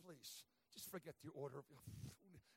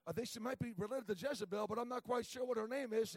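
wry joke; 4 semitones higher, 10 dB louder than usual. Retold or imitated. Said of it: "I said".

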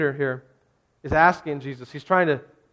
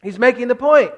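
louder and higher, driving the point home; preaching; 8 semitones higher, 8 dB louder than usual. Real, not acted. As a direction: hammer it at the congregation. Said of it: "preacher repeats line at full force".

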